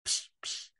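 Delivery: soft voiceless "psh" puffs; imitated snoring puffs. A narrator's reading. Animals hearing a sound under the bed, hushed puffs. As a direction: puff out two hushed little snores. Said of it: "snoring softly".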